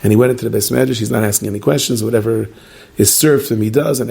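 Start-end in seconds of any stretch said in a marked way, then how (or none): none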